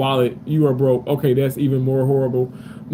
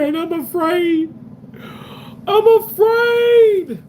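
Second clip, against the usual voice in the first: hammed-up afraid cry, much higher, slow; mock fear; 13 semitones higher, slower than usual. Streamer to chat about a face mask glob, hammed-up fear.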